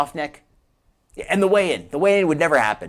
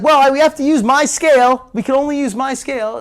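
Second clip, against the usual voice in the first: loud, high mock insistence; hammed-up demand, pitched up and pushed hard; Foodie; 9 semitones higher, 7 dB louder than usual. Host imitates a fighter insisting on her scale, mock stubborn demand. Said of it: "And Foodie's like".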